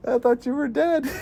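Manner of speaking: high-pitched repeat of the film line; amused mock apology; high quoted apology. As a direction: mimic the character up high.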